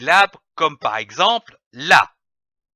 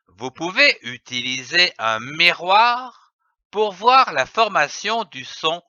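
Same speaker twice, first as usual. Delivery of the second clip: ordinary talk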